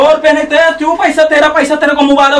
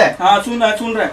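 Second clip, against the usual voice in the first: softer, settled listening; calmly quiet; 4 semitones lower, 5 dB quieter than usual. Real, not acted. Barber calming down to hear the customer out, quiet. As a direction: bring it down and just listen. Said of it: "मैं शांत से सुन रहा हूँ".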